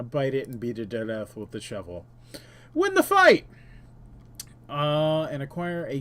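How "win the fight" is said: excited shout. Acted. Game win announcement, shouted high.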